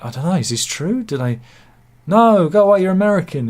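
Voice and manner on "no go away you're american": loud mock Dutch accent, higher. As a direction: shout it like a Dutch waiter. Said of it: "I imagine he's saying, but in a Dutch accent".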